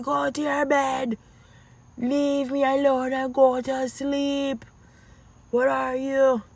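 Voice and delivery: high, mock-exasperated order to go to bed; retold mom scolding. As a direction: scold the kids like a tired mother.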